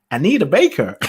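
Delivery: put-on American accent. Shown as a demonstration. Speaker repeating a singer's name for people in America, American accent. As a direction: say it in your best American accent. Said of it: "I'm gonna do my best American accent".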